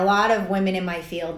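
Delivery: ordinary talk, nothing marked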